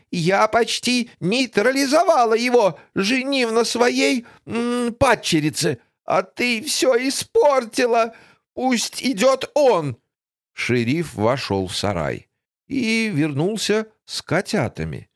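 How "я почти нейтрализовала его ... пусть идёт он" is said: high, angry villain voice; shrill villainess. Acted. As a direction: rant like a wicked stepmother. Griselda rants at Tosya. (злодейка в ярости)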